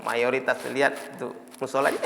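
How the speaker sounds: usual speaking voice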